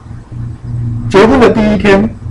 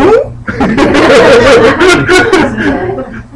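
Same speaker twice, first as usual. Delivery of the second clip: laugh; 7 semitones higher than usual. Real, not acted. Laughing at own story.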